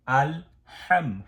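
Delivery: আল হাম with a firm, strong হ, slowly. Shown as a demonstration. Teacher shows the strong হ in আল হাম. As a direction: push air on the হ of হাম. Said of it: "হাটা একটু strong.".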